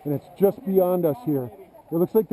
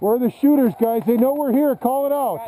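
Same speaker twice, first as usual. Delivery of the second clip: raised voice asking for shooters; loud callout; 6 semitones higher, 3 dB louder than usual. Real, not acted. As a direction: call out urgently over gunfire. Asking for enemy positions.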